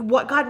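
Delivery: What God said loud, What stressed with a falling tune; emphatic question. Shown as a demonstration. Preacher shows the congregation the new question, loudly. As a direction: punch the word What. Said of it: "makes no sense".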